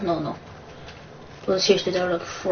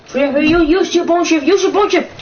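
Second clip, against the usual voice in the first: loud, higher urgent instructions; urgent shouting; 6 semitones higher, 6 dB louder than usual. Real, not acted. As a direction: urge a teammate to act now. Urging partner mid-fight.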